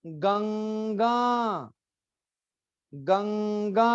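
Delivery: गंगा very slow, each syllable held; slow drawn demo. Shown as a demonstration. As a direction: model गंगा slowly for students to repeat. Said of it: "दोबारा बोलेंगे". (गंगा बहुत धीरे, खींचकर)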